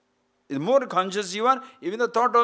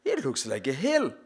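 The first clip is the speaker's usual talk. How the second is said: awestruck tourist; 4 semitones lower, slower than usual. Acted. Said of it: "he looks back and he said".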